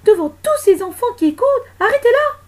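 high, loud outcry rising to a shriek; shrill-pitched shout of horror from the crowd; scandalised protest. Acted. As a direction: protest in outrage, building to a scream. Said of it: "s'arrêtèrent pour protester".

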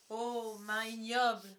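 slow, loud, theatrical reproach; indignant condemnation. A narrator's reading. Peter raises the arrow as a dagger, outraged. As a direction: stretch it out with dramatic outrage.

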